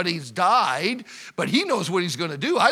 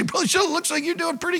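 higher-pitched imitation of a teasing churchgoer; playful, raised-pitch quote of someone butting in; mock congregant voice; 9 semitones higher, faster than usual. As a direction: put on a high voice for a needling listener.

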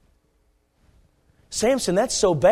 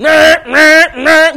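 imitated alarm buzzer, loud and high; blaring alarm; 12 semitones higher, 15 dB louder, much slower than usual. Alarms going off in Samson's life, blaring buzzer. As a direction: honk it out like a warning buzzer. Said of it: "huge alarms going off in his".

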